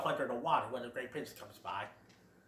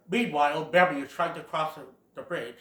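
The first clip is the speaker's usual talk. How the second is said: usual speaking voice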